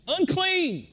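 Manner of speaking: high-pitched warning yell. Retold or imitated. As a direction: cry out to make the crowd scatter. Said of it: "they had to yell at the top of their lungs".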